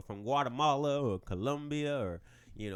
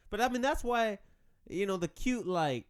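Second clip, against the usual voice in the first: ordinary talk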